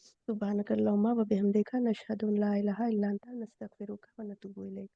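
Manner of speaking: soft, quick recited dua; a teacher closing the lesson with a softly recited prayer. Real, not acted. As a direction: murmur the closing prayer quickly to end the class.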